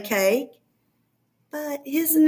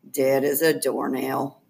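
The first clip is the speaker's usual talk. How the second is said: deadpan; 11 semitones lower than usual. A narrator's reading. Deadpan punchline.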